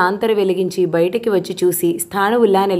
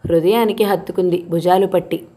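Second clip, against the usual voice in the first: plain reading voice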